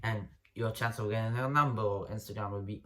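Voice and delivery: ordinary delivery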